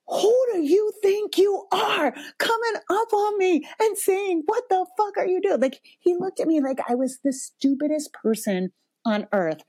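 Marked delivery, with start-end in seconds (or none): 0.1-5.6 high-pitched, indignant parental outburst; retold fury. Mom confronting her son who cursed at her, furious. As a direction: tell him off, voice pitched way up. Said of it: "furious in a way".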